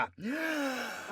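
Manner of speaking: big audible breath in; quick theatrical inhale; sharp gasp. Acted. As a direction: gulp air before racing on. Big breath before next recap part.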